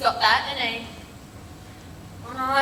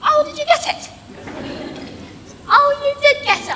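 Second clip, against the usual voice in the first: mock surprise; 12 semitones higher, 5 dB louder than usual. Nan mocking surprise over polish.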